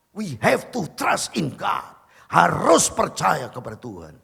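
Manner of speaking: loud, slow, insistent preaching; preacher booming his trust in God to the congregation, loud and slow; fervent exhortation. Acted. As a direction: drive the faith home to the crowd.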